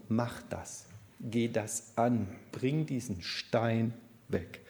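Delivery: slow, deliberate command, each phrase set apart; firm imperative. Shown as a demonstration. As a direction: spell out the order slowly and firmly. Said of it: "Das ist ein Imperativ".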